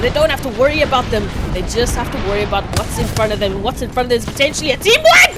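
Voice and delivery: building from steady to loud, high casting; hyped play-by-play. Acted. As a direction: call the fight as it heats up.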